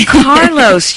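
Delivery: gasp, then a high, drawn-out name; mock amazement. Host reacts to his name, gasping.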